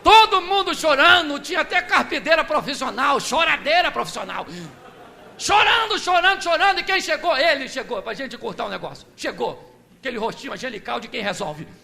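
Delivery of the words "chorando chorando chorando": high, loud calling out of the word; preacher shouting over a crowd of mourners, loud; wailing crowd. Acted.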